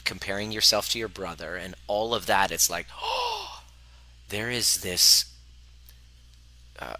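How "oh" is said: much higher startled gasp then oh; hammed-up surprised gasp; mock gasp. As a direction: gasp as if catching the inner voice. Gasp at inner voice.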